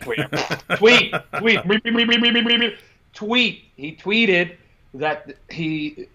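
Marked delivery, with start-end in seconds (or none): 0.8-3.6 high squeaky tweeting; mock bird chirping. Joking after fumbling the word tweet, playful bird chirps. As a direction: chirp it in a rapid, high peep.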